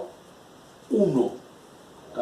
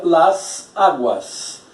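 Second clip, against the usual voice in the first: slow, even "las aguas" for the class; slow model phrase; much slower than usual. Shown as a demonstration. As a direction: spell it out slowly, "las aguas". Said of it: "Eu tenho las".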